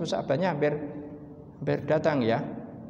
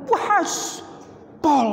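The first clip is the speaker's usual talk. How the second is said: delighted guest; 13 semitones higher than usual. Retold or imitated. Guest raving about the food, very high. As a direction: burst out in delight. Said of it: "Njur kon crita".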